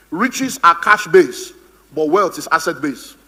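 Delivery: very slow, deliberate teaching line; preacher stressing riches versus wealth, very slowly; slow emphasis. Shown as a demonstration. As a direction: spell the contrast out word by word. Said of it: "teaching contrast".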